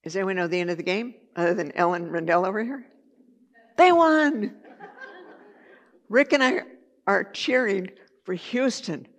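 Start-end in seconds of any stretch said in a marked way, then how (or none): 3.8-4.6 high, loud burst with a laugh; laughing triumphant cheer; excited cheer. Real, not acted. Excited cheer about the game. 6.1-9.1 higher and louder, building; rising excitement as the story peaks; excited. Real, not acted. Cheering, moved by sobbing boy.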